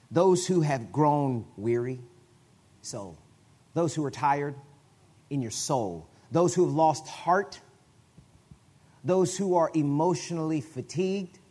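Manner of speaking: each phrase slow and drawn out; slow weighty reading. Real, not acted. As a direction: let every word land. Slow emphatic scripture paraphrase.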